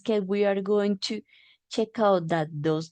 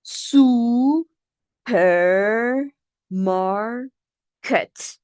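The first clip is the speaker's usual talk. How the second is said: supermarket stretched very slowly, higher, syllable by syllable; very slow syllable drill; 5 semitones higher, much slower than usual. Shown as a demonstration. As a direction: draw out supermarket for the class. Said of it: "next word".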